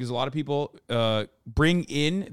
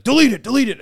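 high loud repeated demand; impatient order; 9 semitones higher, 6 dB louder, faster than usual. Retold or imitated. Officer forcing the photo deletion, loud high demand. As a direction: snap the order twice. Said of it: "yelling at me".

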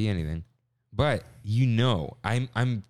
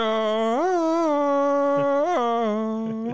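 loud high laugh at own cracked voice; embarrassed burst of laughter; 4 dB louder than usual. Real, not acted. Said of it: "hear my voice crack".

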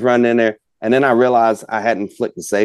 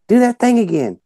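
very high imitated child voice; little girl; 4 dB louder than usual. Daughter repeating her request to her father, insistent child's plea. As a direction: mimic a kid repeating herself insistently. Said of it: "And she's like".

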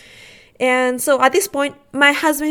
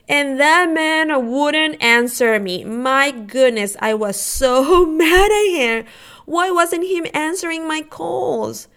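higher, slow, drawn-out exasperation; exasperated venting; 5 semitones higher, 5 dB louder, slower than usual. Retold or imitated. Wife venting about husband not answering, exasperated. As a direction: vent frustration, stretching the words.

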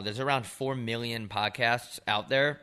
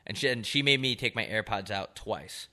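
usual speaking voice

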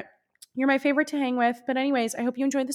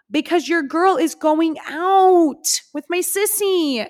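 put-on higher, slow, drawn-out singsong on "your girl is going out"; playful singsong; 6 semitones higher, 3 dB louder, slower than usual. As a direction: sing it out, showing off the night plans. Repeat, sung-out emphasis.

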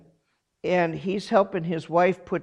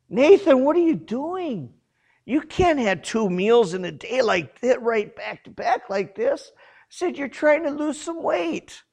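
mock scolding; 8 semitones higher than usual. Scolding his brother, retold.

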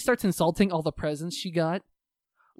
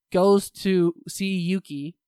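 ordinary talk

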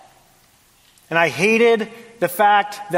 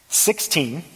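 ordinary delivery

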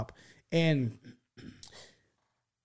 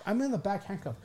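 ordinary delivery

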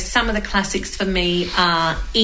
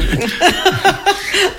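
laugh; 10 semitones higher than usual. Real, not acted. Laughing at own joke.

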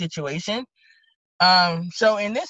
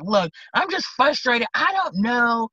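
higher, exasperated protest; pleading frustration, pitched up; frustrated; 5 semitones higher than usual. Retold or imitated. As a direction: imitate a worked-up woman venting. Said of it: "getting a little loud".

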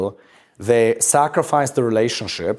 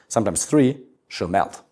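ordinary delivery

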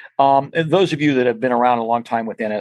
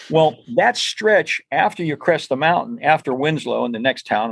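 ordinary talk

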